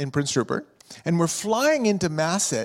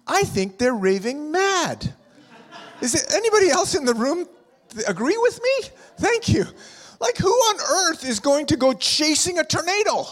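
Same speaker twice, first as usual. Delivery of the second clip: joking; 11 semitones higher than usual. Real, not acted. Joking about storm chasers.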